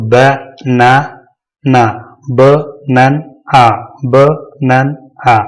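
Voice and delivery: each piece alone with long gaps: ब, नन, आ; split into syllables. Shown as a demonstration. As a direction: sound out ब नन आ slowly, piece by piece. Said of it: "पढ़ेंगे इसे तोड़कर".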